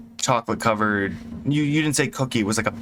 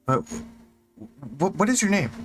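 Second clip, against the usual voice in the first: eager question; 6 semitones higher than usual. Acted. GM's NPC eagerly asks the cook.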